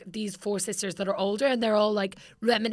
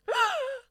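gasp. Acted. Mock shock mid-letter.

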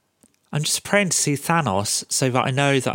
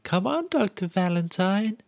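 high breathy character voice; quoting a character; 11 semitones higher than usual. Retold or imitated. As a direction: mimic her pleading tone. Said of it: "she's like".

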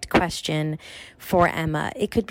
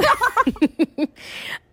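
high laugh. Real, not acted. Co-star laughing at her own party-girl joke. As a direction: burst of high giggling.

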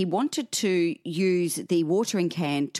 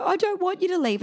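high-pitched imitation of an upset little girl; retold tearful plea, pitched way up; mock child's pleading; crying child; 13 semitones higher than usual. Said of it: "and she was crying".

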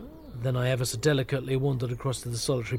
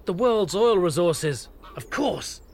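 raised, emphatic exclamation; sudden realisation; 6 semitones higher, 4 dB louder, slower than usual. Acted. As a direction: blurt it out like a revelation. Narrator suddenly realises the moles' plot.

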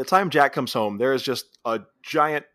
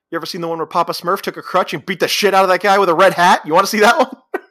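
loud, higher-pitched rant building to a challenge; mock threat; 7 semitones higher, 8 dB louder than usual. Jack threatening the guy watching Smurfs, building mock rage.

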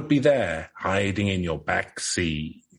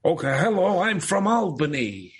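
put-on a light upstate New York accent, pitched up; Albany accent; 8 semitones higher than usual. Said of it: "what do you want me to do? The Albany accent?".

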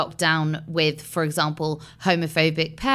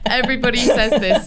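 laughing; 6 semitones higher, 5 dB louder, faster than usual. Real, not acted.

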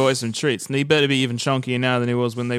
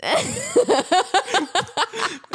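high laughter. Real, not acted. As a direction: burst out laughing. Laughing at own accent.

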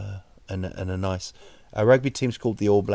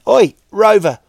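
higher and louder, casual shouted errand; mock owner ordering the dog; 6 semitones higher, 5 dB louder than usual. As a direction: call out to the dog like a lazy bloke on the couch. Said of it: "T V going".

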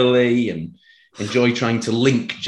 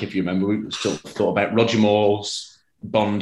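normal talking voice

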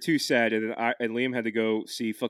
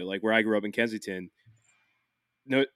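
ordinary delivery

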